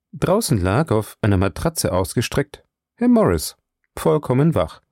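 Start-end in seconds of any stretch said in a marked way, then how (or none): none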